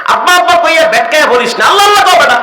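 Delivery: quick, high, loud scolding; fast angry rebuke. Retold or imitated. Father scolding his son, fast and shouted. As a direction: snap at the boy, fast and loud.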